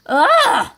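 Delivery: high, loud yelp of revulsion; innkeeper's disgusted cry. Acted. Innkeeper seeing a goblin in the inn, loud disgusted cry. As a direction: cry out, revolted. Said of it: "cried the innkeeper".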